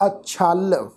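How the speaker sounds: "ਅਛਲ" as short a plus chhal; careful model of "ਅਛਲ", a-chhal; pronunciation demo. Shown as a demonstration. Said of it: "ਇਸ ਸ਼ਬਦ ਦਾ ਪਾਠ ਉਚਾਰਨ ਕਰਾਂਗੇ".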